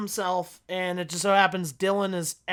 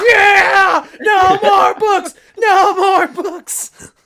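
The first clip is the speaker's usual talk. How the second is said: much higher and louder, slow triumphant yelling; excited shout; 11 semitones higher, 11 dB louder, much slower than usual. Retold or imitated. Fan finally seeing the scene, shouting along in glee. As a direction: cheer the line like your team scored. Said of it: "I'm like".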